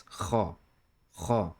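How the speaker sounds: "Kha" with friction from the back of the throat; strong throaty kha. Shown as a demonstration. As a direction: scrape the throat slightly to start "kha". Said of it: "It is a strong, throaty kha".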